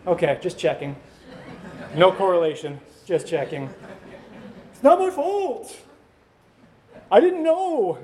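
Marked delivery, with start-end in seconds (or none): 4.7-8.0 put-on high, whiny child pleading innocence; whiny kid excuse. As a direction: play the kid who insists it isn't his fault. Kids excusing themselves.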